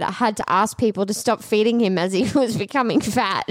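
ordinary talk, nothing marked

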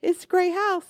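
high-pitched retold boyfriend protest; small, high voice from the background; man in the background, imitated. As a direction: lightly mimic a boyfriend piping up from the back. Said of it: "you just hear him in the back going".

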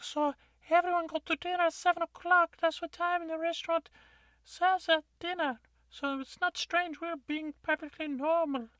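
odd townsperson. Acted. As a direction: squeak out a nervous excuse. Player voices odd townsperson.

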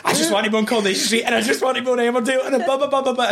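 fast and high, eager rambling; excited rush. Retold or imitated. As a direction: gush like an impatient kid. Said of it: "I remember being like".

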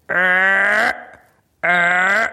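mimicked mechanical grinding drone; two droning "errr" machinery noises; imitated undercarriage noise. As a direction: imitate landing gear straining to lift. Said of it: "uh, like this".

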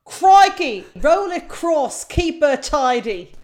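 loud, high, drawn-out crikey; showy announcement. Shown as a demonstration. Quiz setter unveiling her silly cricket acronym, showy announcement. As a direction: proclaim it slowly, phrase by phrase. Performing a comic acronym on request.

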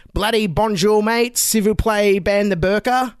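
a jokey Australian ocker voice mangling French; mock Australian accent. Translator dubbing the English debate, in a mock Australian accent. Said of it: "He's like".

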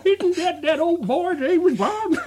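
high, slow, laughing southern drawl; mock the southern accent while laughing; hammed-up southern accent; southern sheriff impression. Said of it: "He did a sort of".

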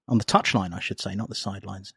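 ordinary delivery

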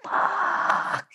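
breathless whispered swear; stunned whisper at bad news; whispered curse. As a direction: let it slip out under your breath. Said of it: "I just went".

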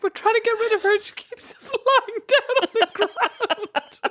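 high, slow and overcome with giggles; laughing mock complaint. Imagined kidnappers complaining about their captive, cracking up. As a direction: struggle to get the quote out for laughing.

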